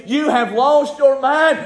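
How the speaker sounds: high, slow, preached out; drawn-out, forceful mockery of doubters; scoffing accusation. Voices the scoffing people.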